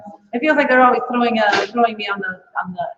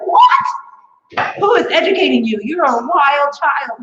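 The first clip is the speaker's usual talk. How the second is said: shocked outburst; 4 semitones higher, 3 dB louder, slower than usual. Retold or imitated. Comic telling a kid in disbelief, mock outrage. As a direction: act floored that a kid doesn't know J Lo. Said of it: "And I'm like".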